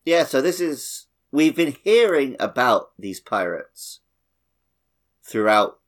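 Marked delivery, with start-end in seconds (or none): none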